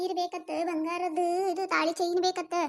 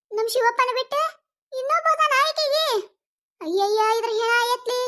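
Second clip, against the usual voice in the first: higher, louder outraged exclaiming; shocked outrage; 5 semitones higher, 4 dB louder than usual. Acted. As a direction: gasp it out in scandalized disbelief. Eavesdropper shocked at second lover.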